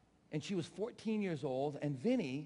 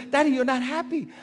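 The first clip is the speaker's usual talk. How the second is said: lightly voiced daughter, higher and loud; daughter's protest; 8 semitones higher, 13 dB louder than usual. Father imitating daughter accusing Daddy, loud mock protest. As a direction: mimic a daughter's loud complaint to her father. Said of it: "And my daughter says".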